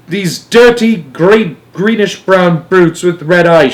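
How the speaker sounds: loud, high, emphatic description; shouting. A narrator's reading. Astronaut butting in on the radio, shouting. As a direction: shout over the others. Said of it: "shouted Shanks".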